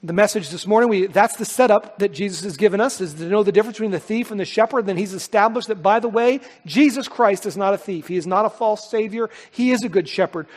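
usual speaking voice

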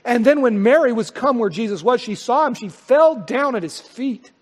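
high, loud pulpit reading; forceful scripture reading. Acted.

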